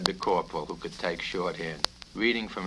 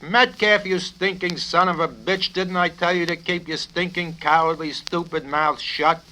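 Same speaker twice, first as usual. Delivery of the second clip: loud, high tirade; furious rebuke; 10 semitones higher, 8 dB louder than usual. Acted. Colonel shouting down Major Metcalf, furious. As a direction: tear into him.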